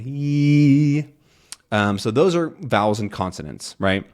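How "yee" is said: "Yee" sung out high and open; open vowel. Shown as a demonstration. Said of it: "open vowel sound".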